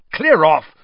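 loud, sharp snap; snapped. A narrator's reading. Ron drives off the giggling girls, loud snap. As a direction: bark them away. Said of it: "Ron snapped at them".

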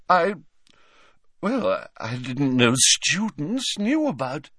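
slow, high, flustered hedging; caught-out, hesitant professor; shifty. A narrator's reading. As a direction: stall evasively with pauses. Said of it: "She looked suddenly shifty".

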